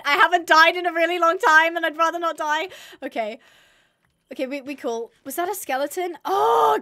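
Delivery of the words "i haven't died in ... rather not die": loud, high pleading; desperate plea. Real, not acted. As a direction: beg not to die. Pleading with mob.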